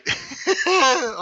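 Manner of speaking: short, high, loud laugh; quick burst of real laughter. Real, not acted. Viewer starting his reaction to the bit, loud laughing. Reacting after the clip ends.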